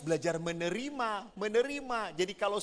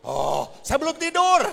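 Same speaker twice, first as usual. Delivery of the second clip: higher, louder startled husband; flustered husband; 11 semitones higher, 10 dB louder than usual. Retold or imitated. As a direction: caught out, blurt it. (suami kaget menjawab, keras dan tinggi)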